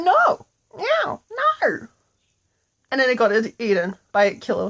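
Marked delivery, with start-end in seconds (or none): 0.0-1.9 high, frantic squealing no; cat shrieking in high panic; panicked cat. Acted. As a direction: squeal as you get eaten. Cat being eaten by butterflies.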